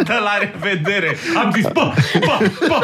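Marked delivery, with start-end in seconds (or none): 1.6-2.8 high-pitched, amused rapper parody; generic rapper. Retold or imitated. Artist repeating the rappers' goodbye, laughing mock voice. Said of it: "așa vorbesc toți rapperii".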